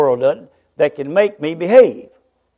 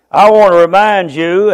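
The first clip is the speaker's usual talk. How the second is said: ordinary delivery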